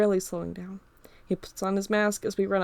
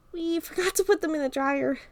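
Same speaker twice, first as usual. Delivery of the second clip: Carl's voice; 9 semitones higher than usual. Acted. Carl voiced by narrator.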